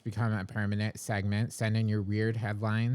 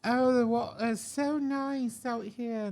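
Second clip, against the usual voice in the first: high, slow airy influencer voice; South African accent; 13 semitones higher, slower than usual. Retold or imitated. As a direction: coo it like a carefree tourist. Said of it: "You did such a good accent".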